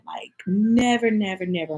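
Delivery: 「never」を高く、ゆっくり強調して繰り返す; emphatic never. Real, not acted. Vowing never to feel that again.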